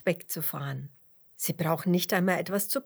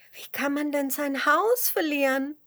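high, louder curious question; neugierige Frage; 8 semitones higher, 5 dB louder than usual. A narrator's reading. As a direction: ask with lively wonder. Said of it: "fragt Dotti neugierig". (hoch und neugierig fragend)

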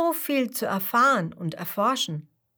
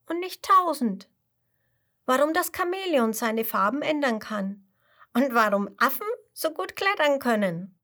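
put-on high child voice rattling off questions with wonder; a little cub bursting with curiosity; eager tiger cub; 7 semitones higher, 3 dB louder than usual. Tiger cub telling the chameleon everything it wants to know, high and eager.

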